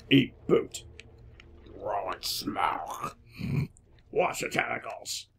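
low, hushed game-character lines; game catchphrases. Retold or imitated. Player repeating the game's stock lines, low and husky. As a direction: growl out slow, husky one-liners. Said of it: "three lines that we just keep repeating".

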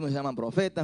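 ordinary talk, nothing marked

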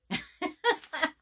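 quick high delighted giggle. Real, not acted. Viewer giggling at cat ears on the pouch, high delighted giggle. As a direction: giggle at something cute.

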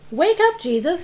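loud, high wake-up call; urgent storybook shout; narrated shout. A narrator's reading. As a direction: shout to rouse a sleeping friend. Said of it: "his friend shouted".

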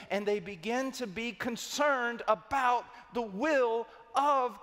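high, loud and stretched-out words; full-force preaching. Real, not acted. Preacher urging concern for the Father's will, full-force preaching. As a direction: drive each word out high and forceful.

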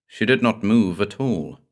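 usual speaking voice

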